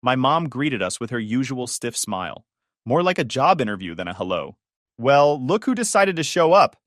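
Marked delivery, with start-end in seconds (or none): 5.0-6.8 sneering welcome, a bit louder; sarcastic jab. A narrator's reading. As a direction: greet them with a cold sarcastic dig. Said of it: "she said".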